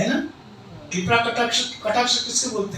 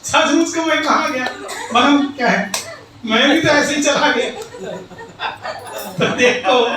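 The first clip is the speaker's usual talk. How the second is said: loud, high, laughing punchline; 3 semitones higher, 5 dB louder than usual. Acted. Preacher delivering the joke's punchline, laughing loud. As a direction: tell it through laughter.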